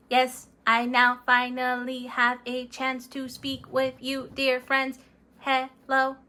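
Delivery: flat, even robot monotone greeting friends; Mister Robot. Acted. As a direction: speak like a polite robot, no tune. Speaker now plays Mister Robot.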